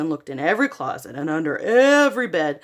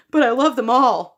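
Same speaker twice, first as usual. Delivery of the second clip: put-on louder, higher child protest; cried out; 5 semitones higher, 6 dB louder than usual. A narrator's reading. Boy protesting to mom, cried out. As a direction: plead for the toys. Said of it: "Spencer cried".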